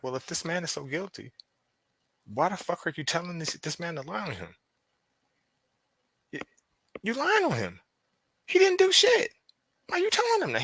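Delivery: rising high, outraged questioning; a detective scolding police, pitch climbing to indignant heights. Retold or imitated. Detective scolding the police, climbing high and indignant.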